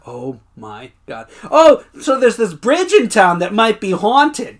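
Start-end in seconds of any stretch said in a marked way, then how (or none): 1.5-4.6 high, loud and slow chatter; chatty lady voice. Retold or imitated. Imitating the weird juror lady who talked to her. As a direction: babble like an oddball stranger.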